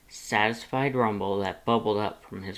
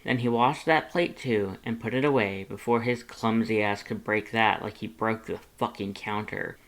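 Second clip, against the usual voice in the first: usual speaking voice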